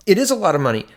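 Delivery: ordinary talk, nothing marked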